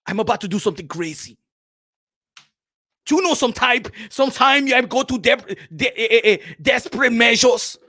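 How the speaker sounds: brooding African boxer, halting; ominous confession in African accent; hesitant, building towards a threat; Makabu. Acted. Makabu hints at desperate act.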